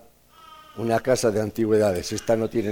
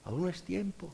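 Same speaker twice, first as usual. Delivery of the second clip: calm potter; 6 semitones higher, 10 dB quieter than usual. Acted. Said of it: "Y me decía".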